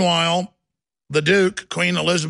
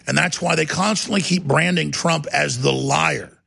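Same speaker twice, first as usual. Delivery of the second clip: ordinary talk, nothing marked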